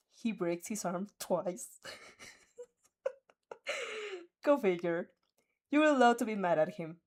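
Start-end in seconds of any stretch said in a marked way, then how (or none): 1.8-5.1 laughing go figure; a laughing aside. Real, not acted. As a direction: laugh it off, amused.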